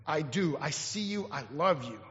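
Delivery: soft, low declaration of love; tender vow. Acted. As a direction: say it softly like an intimate vow. Imagined vow the woman longs for.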